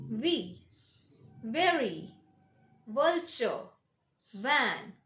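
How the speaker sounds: V, very, vulture, van with buzzed v; careful isolated model words; lip-biting V. Shown as a demonstration. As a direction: bite the lip on each V. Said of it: "this is the way to pronounce".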